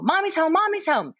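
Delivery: put-on high, loud happy child's shout; excited yell. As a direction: call out Mommy's home, thrilled. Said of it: "I run and yell".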